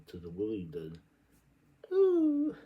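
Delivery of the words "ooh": a shrill mocking ooh, loud; exaggerated high-pitched ooh. Mock exclamation in the tale.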